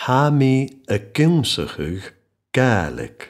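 slow, word-by-word model phrase with pauses; slowly. Shown as a demonstration. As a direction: say it slowly for learners, pausing before "Gaelic". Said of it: "I'll say it slowly".

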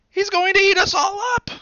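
very high, loud frightened scream; high loud terrified children's scream. A narrator's reading. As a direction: scream in terror as a group of kids. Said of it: "they screamed".

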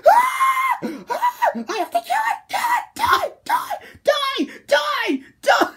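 frenzied high-pitched screaming; mindless rage freak-out. Acted. Fan imagining killing the monster in Shinji's place, screaming rage. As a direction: scream each word in a wild frenzy. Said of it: "I would be freaking out".

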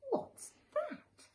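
put-on very high little boy's question; curious child. Little Chester asking about the kissing hand, high and curious. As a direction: pipe up like a tiny curious kid.

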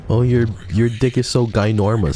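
mock the line with mild fake awe; mocking chat. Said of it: "Say that".

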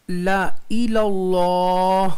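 ला इलल्लाह slow, last vowel held long; recitation demo. Shown as a demonstration. Teacher shows students the reading, drawn-out ला इलल्लाह. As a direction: chant ला इलल्लाह on a sustained level note. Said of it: "ज़ैद अलिफ को नहीं पढ़ेंगे".

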